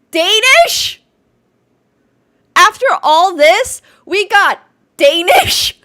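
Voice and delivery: loud, high, stunned disbelief; drawn-out, outraged astonishment; incredulous outcry. Real, not acted. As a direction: shout it as an appalled question. Shocked by the accent guess.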